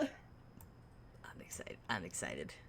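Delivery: whispered twice, barely voiced; excited whisper. Real, not acted. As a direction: breathe it out with glee. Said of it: "I'm excited to hear it".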